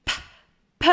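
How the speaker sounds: "p" as isolated short puffs; letter sound only. Shown as a demonstration. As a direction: puff out each "p" without naming it. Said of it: "On n'est même pas obligé de la nommer". (« p » soufflé, isolé)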